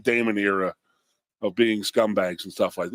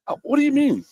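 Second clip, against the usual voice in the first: high sarcastic disbelief; mock incredulous; 6 semitones higher, 3 dB louder than usual. Retelling his sarcastic reply to Notre Dame staff, mock incredulous. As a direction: scoff at the welcome in mock disbelief.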